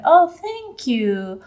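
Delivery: drawn-out, lifted "Oh, thank you"; slow demo thanks. Shown as a demonstration. Teacher to students, slow clear thanks. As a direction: model it slowly for the class. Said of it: "también de decir".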